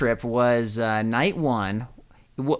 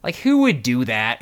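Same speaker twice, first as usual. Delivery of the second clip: ordinary delivery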